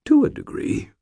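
weakened, amiable concession; faint, friendly admission; affable but weak. A narrator's reading. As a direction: concede pleasantly with little strength left. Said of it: "Sir Stuart said affably, weakly".

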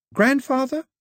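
high, rising bewildered query; puzzled young girl, pitched high. A narrator's reading. Daphne still bewildered.

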